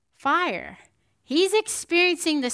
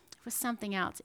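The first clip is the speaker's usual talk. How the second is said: normal talking voice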